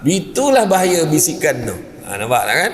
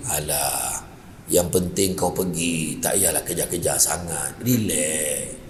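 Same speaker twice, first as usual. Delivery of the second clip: low, soft tempting whisper; devil's whisper; 11 semitones lower, 8 dB quieter, slower than usual. Acted. The devil whispering temptation to the worshipper, low and soft. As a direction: coax him softly to be lazy. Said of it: "dia bisik".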